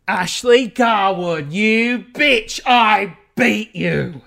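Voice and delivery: slow, drawn-out taunt; triumphant gloating. Acted. Winner addressing the beaten school rival, slow triumphant gloating. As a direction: savour each word in mock-vicious victory.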